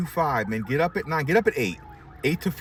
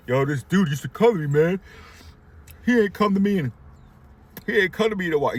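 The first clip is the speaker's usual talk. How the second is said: hammed-up complaining barber, a bit louder and higher; mock whiny barber; 6 semitones higher, 4 dB louder than usual. Mocking barbers who cry over lost customers, whiny and louder. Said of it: "the people crying like".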